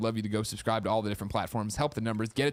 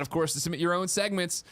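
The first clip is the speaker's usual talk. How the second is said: ordinary delivery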